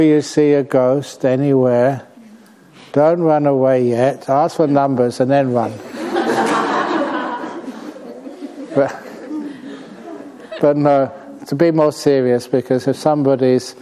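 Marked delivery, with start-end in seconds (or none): 5.8-8.7 breathy high-pitched chuckle; high airy laugh. Real, not acted. Monk laughs after advising to ask ghosts for numbers, airy and high. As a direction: laugh lightly at your own joke.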